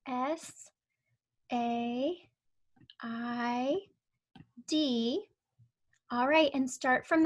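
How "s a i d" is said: letters spaced out one by one; spelling out letters. Shown as a demonstration. Teacher spelling a sight word while tracing, slow. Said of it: "let's trace the word said".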